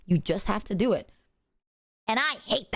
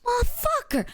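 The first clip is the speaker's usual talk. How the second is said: mock fish groan; 11 semitones higher than usual. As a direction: whine it like a hooked fish.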